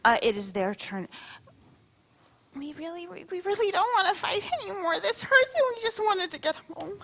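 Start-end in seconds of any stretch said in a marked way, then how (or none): 2.6-7.0 high, pleading monster voice; pleading creature voice. Acted. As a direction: beg like a small weary creature.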